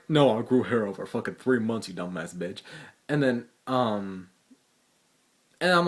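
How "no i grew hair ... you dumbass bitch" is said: imagined sassy comeback. Retold or imitated. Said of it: "And I'm like".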